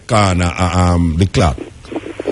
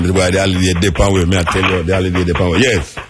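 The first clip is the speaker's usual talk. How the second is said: ordinary delivery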